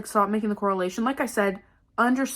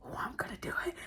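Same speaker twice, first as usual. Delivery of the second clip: barely audible whispered threat, imitated; imitated eerie whisper, hardly any voice; menacing whisper; 7 semitones lower, 19 dB quieter than usual. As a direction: whisper it creepily into someone's ear. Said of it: "It came up, like, maybe two or three".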